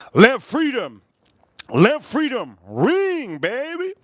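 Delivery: proclaiming freedom. Retold or imitated. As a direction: belt it out like a patriotic anthem. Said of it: "He says over here".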